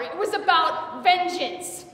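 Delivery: high, loud, slow declaration; vengeful declaration. Acted. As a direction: build to a fierce "vengeance". Declares her motive fiercely.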